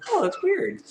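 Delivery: very high little squeak; weirded-out girl. Acted. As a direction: squeak it to yourself, puzzled. Girl's private reaction.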